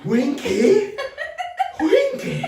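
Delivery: very high, loud mimicked squeal; laughing friend. Friend being filmed and refusing to explain, squealing laughing mimicry. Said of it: "She just keeps laughing".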